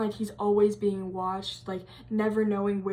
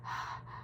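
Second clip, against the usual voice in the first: soft, voiceless excited panting; eerie hushed huffing; creepy breathing; 13 dB quieter than usual. Retold or imitated. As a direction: imitate the lurker's eager breaths. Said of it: "and, like, just".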